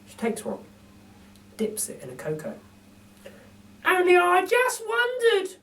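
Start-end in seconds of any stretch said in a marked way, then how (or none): 3.8-5.6 very high, slow, chirpy lady voice; a cheeky cockney burglar lady; Burglar Betty. A narrator's reading. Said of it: "she says".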